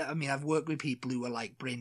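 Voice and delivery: ordinary talk, nothing marked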